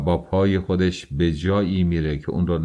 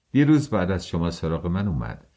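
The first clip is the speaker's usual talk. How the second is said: ordinary delivery, nothing marked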